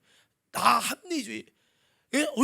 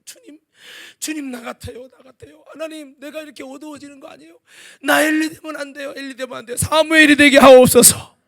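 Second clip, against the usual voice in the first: pleading, ending in a loud cry; earnest plea; 4 semitones higher, 15 dB louder than usual. Retold or imitated. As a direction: beg God, building to a cry. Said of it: "간절한 간절".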